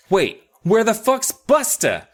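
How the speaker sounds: sudden alarm. Retold or imitated. Mindy noticing the dog is missing, mock alarm. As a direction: realize the dog is gone, hammed-up.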